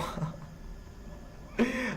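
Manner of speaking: high, soft wheezing laugh; helpless laughter. Real, not acted. Host tickled by friend's way of talking, wheezy high laughter. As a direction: laugh until the sound thins out.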